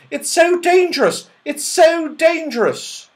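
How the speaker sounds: high, loud frightened wail; crying out in fright. Acted. Elf scuttling off in fright, high loud wail. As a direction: cry it out as you scurry off. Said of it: "scuttled off crying".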